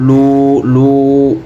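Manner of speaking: لو held slow with lips rounded; rounded waw. Shown as a demonstration. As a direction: round the mouth on لو. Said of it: "ওয়াওতে মুখ গলা হবে".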